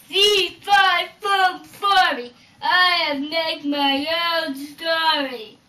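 slow loud booming giant chant. Acted. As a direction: boom it out like a giant. Giant booms his rhyme.

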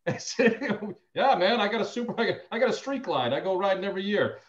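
acted-out chummy enthusiasm; enthusiastic client. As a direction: brag eagerly about your bike. Acts out enthusiastic Harley-owning client.

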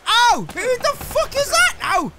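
high-pitched, loud yelp of outrage; startled yell. Real, not acted. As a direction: cry out as if suddenly hit. Hit unexpectedly in the game.

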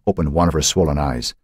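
ordinary delivery

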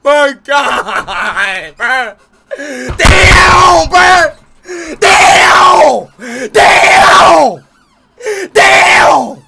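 high, loud excited shouts; real overjoyed shouting; ecstatic yelling. Real, not acted. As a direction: scream in disbelief and joy. Overexcited reaction to matches.

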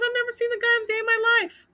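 high-pitched, indignant retold denial. Husband tells wife he doesn't know the kid, indignant denial. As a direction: protest it high, imitating the accused man. Husband denies knowing the accuser.

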